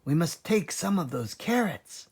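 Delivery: a little cub piping up; small fox cub. A narrator's reading. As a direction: smallest fox asking his dad.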